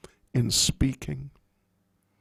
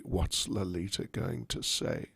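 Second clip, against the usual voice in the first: anxious; 8 semitones lower, 10 dB quieter than usual. Acted. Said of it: "Radharani's in anxiety".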